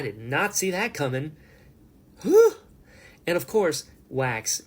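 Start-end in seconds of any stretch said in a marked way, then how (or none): 2.2-2.6 high loud whistle-like whew. Real, not acted. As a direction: blow out a high whoosh of awe.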